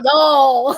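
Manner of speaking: "y'all" stretched into one long syllable; drawn-out y'all. Shown as a demonstration. Teasing how they teach it, drawn-out y'all. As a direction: draw out "y'all". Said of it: "and then drawing out".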